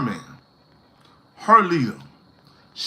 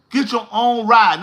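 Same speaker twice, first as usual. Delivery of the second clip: ordinary talk, nothing marked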